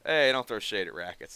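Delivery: ordinary talk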